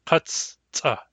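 Katsta split in two, kats, pause, tsa; by syllables. Shown as a demonstration. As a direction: release both C's in Katsta, with a gap between syllables. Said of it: "When we pronounce them by syllables".

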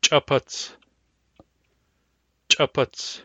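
glottalized ch opening "Chaputs"; hard C wedge. Shown as a demonstration. Teacher giving the example word for hard C wedge, glottalized "Chaputs". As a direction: pop the ch of "Chaputs" with a closed glottis. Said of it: "Hard C wedge".

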